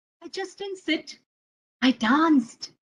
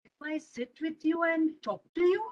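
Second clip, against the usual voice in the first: ordinary delivery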